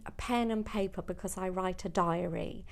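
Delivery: ordinary delivery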